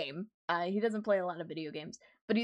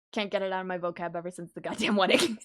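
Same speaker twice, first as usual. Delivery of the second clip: ordinary talk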